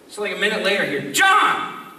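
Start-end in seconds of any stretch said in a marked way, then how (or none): 1.1-1.9 high, insistent imitated call; wife calling. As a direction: mimic a wife calling through the house. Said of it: "distinct voice of my wife".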